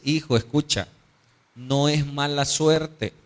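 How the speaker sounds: slow, measured fatherly counsel; deliberate words, weighing each phrase; wise old father. Acted. Wise father correcting his desperate son, slow and measured. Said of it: "un anciano sabio".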